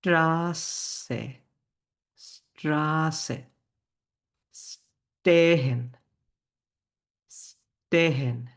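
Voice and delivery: স্ট্রাসে and স্টেহেন slow, opening "sh" held apart; German pronunciation demo. Shown as a demonstration. Teacher models cluster words, স্ট্রাসে and স্টেহেন drawn out. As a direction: hush first, then stretch the vowel of স্ট্রাসে, স্টেহেন. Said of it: "এরকম উচ্চারণ হয়".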